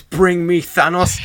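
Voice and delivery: slow, high mock battle cry; mock yell. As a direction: bark it out like Thor. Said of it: "just yells".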